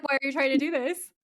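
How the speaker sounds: high, fast incredulous quote; mock exasperation; exasperated Sam.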